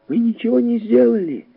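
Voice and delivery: put-on high-pitched children's protest; high, childlike voice; children in chorus. A narrator's reading. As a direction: answer like kids protesting together. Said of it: "хором ответили дети".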